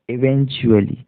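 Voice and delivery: normal talking voice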